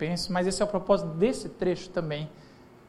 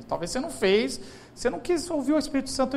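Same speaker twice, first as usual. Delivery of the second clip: usual speaking voice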